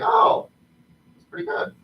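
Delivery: put-on very high quavering old man. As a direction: squeak it like a frail old man. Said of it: "And he's like".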